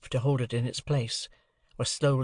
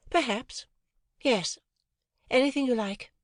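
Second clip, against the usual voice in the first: put-on lighter, higher young lady's voice; cool, airy society girl; a lightly raised ladylike voice; Estella; 11 semitones higher than usual.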